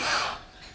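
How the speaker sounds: soft breathy mock gasp; a quick pretend intake of surprise; surprised gasp. Shown as a demonstration. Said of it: "make a surprise face".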